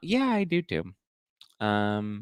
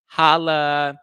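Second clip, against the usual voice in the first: playful holla; 8 semitones higher, 8 dB louder than usual. Acted. Signing off a line playfully.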